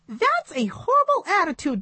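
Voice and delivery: high puppet voice scolding; disapproving puppet monkey. A narrator's reading.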